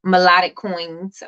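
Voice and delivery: ordinary delivery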